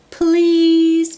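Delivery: high, loud pleading please; begging bird. Acted. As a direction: beg him, drawn out and loud. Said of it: "begged the bird".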